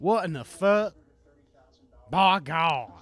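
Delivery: loud, indignant Southern bumpkin; Southern country accent. Acted. Bumpkin heckling an egghead, Southern country accent. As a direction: exclaim it in an outraged country accent. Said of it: "country accent and my rendition of a Southern".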